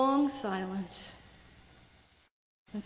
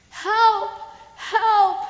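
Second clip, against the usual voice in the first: high, loud cries for help; yelling for help; 7 semitones higher, 6 dB louder than usual. Acted. Man hanging off a cliff yells for help, loud and high. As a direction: call out desperately from the cliff. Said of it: "he yelled".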